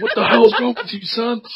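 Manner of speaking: high-pitched, incredulous telling-off; exasperated high-voiced parent; mock scolding parent. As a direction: scold the son in disbelief. Acted parent scolding a son.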